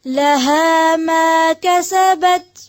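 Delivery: slow, melodic Quranic chanting; Quran recitation. Real, not acted. Teacher recites verses for students to follow, slow melodic chant. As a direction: draw out each verse in tajweed melody.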